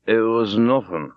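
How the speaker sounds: put-on slow, thick, drunken slur; speaking thickly. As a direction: mumble it through a drunk, heavy tongue. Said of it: "He spoke very thickly".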